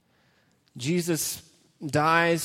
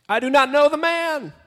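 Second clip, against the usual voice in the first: higher, louder, desperate cry; exclaimed denial; 9 semitones higher, 4 dB louder than usual. Acted. Peter shouting at the crowd, loud desperate denial. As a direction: shout the denial like a cornered man. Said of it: "There's an exclamation point there".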